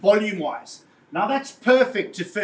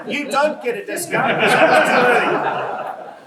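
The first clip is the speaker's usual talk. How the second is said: louder, laughing through the words; laughing while talking; 3 semitones higher, 6 dB louder than usual. Real, not acted. Presenter teasing an audience member about a discount, laughing loudly. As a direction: get the joke out while still laughing.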